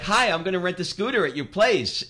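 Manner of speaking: high, eager American customer; naive American tourist. Acted. American tourist walks into the Greek rental shop, chirpy. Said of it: "you walk in as the American".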